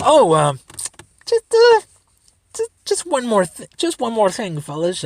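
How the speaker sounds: high, hesitant detective with false starts; Columbo. Retold or imitated. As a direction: fumble into an offhand afterthought, imitating a TV sleuth. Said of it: "He fucking Columboed our ass".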